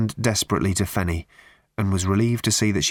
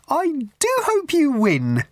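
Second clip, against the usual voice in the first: put-on a prim, simpering old charity lady pushing tickets; high twittering church-fete lady; raffle woman. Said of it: "she said, with a smile".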